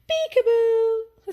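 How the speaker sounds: put-on high, slow, playful kid voice; high drawn-out peekaboo; little boy. A narrator's reading. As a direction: sing it out like a toddler hiding.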